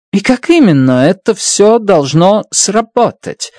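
insistent. A narrator's reading. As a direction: press hard for an answer, stressing «именно». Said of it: "настаивала Страт".

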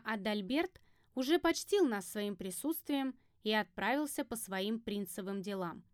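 ordinary delivery

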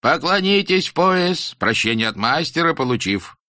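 high, slow, solemn schoolmaster; stern teacher voice. Acted. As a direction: preach it like a strict old teacher. Teacher instructs leaving pupils.

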